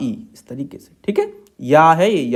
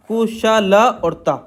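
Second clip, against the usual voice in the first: slow, drawn-out parts: कुशल और ता; slow spelled out; 4 semitones higher, much slower than usual. Shown as a demonstration. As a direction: spell कुशल और ता out slowly, part by part. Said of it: "इस तरीके से बनाएंगे आप कुशलता को". (धीरे-धीरे टुकड़ों में)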